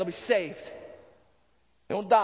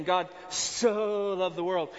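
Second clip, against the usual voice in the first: "For God so loved the world" read slowly, low and deliberate, leaning on "so"; slow emphatic recitation; 3 semitones lower, much slower than usual. Shown as a demonstration. Preacher slowly quoting John to the congregation, deliberate and low. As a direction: lay out "so" slowly, each word weighted. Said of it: "please understand this word "so".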